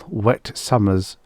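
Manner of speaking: ordinary delivery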